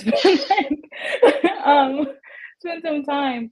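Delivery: laughing while admitting confusion. Real, not acted. Host laughs about forgetting.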